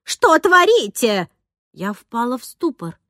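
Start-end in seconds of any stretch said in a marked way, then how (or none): 0.0-1.3 loud, high, outraged demand; enraged nanny bursting in; furious shout. A narrator's reading. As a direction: yell it accusingly. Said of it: "закричала она на меня".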